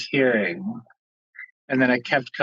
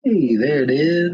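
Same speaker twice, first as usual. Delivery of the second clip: laughing as the call connects; a laugh through the words; 3 dB louder than usual. Real, not acted.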